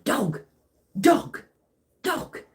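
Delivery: put-on high-pitched little kid calling out a word; child-like mimicry in punchy bursts; imitating excited toddler. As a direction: mimic a small child's short, repeated exclamations. Imitates small child repeatedly saying dog.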